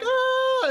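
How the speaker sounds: very high held shriek; acted scream of alarm, way up high; mock scream. Pandora as the problems escape, screaming.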